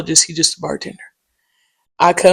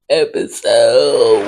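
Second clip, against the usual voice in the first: drawn-out hype shout; 7 semitones lower, much slower than usual. Real, not acted. Hyping the hundredth episode.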